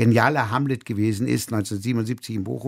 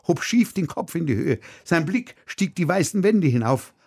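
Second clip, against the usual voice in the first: ordinary delivery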